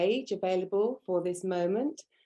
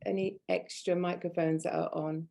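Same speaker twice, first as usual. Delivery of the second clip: ordinary delivery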